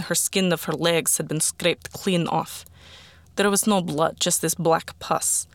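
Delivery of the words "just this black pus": ordinary delivery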